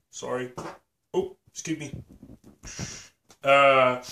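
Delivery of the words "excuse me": normal talking voice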